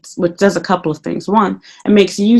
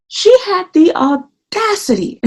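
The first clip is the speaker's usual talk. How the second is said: indignant; 10 semitones higher, slower than usual. Real, not acted. Indignant emphasis, slow high.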